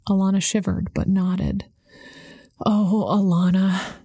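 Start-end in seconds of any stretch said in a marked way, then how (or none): 2.7-4.0 slow, softened motherly tone; soften, gently coaxing her daughter. A narrator's reading. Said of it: "Mom's voice softened".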